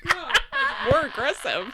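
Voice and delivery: real laughing. Real, not acted. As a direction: giggle while you object. Laughing while correcting accent.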